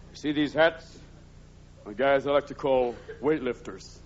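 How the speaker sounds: usual speaking voice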